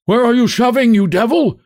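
high-pitched angry shout from a passer-by; sudden high, indignant street shout. A narrator's reading. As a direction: yell it at a driver in your way. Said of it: "hears shouts from the dark mass".

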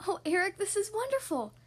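bright, high, thrilled Ariel; excited. A narrator's reading. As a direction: twirl with joy in your voice. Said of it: "Ariel said excitedly".

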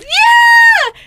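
loud, very high mock cheer; hammed-up screaming whoop; imitated cheer. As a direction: mimic a woman shrieking in celebration. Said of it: "and she goes".

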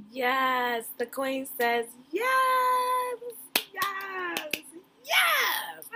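thrilled cheer. Real, not acted. Cheering the on-screen proposal.

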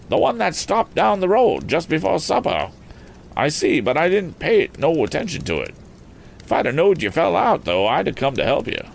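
high-pitched rustic explanation; country farmer. Acted. Farmer telling Tom about the passing auto, high rustic voice.

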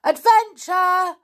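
high, loud drawn-out shout; child's slow, ringing call; yelled back. A narrator's reading. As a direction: yell the secret password back. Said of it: "yelled back Janet".

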